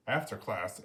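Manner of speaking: ordinary delivery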